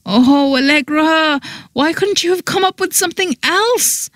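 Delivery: high, softened anguished whine; dismayed reproach. A narrator's reading. Mortified woman to meddling friend, dismayed reproach. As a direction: groan the complaint, climbing very high.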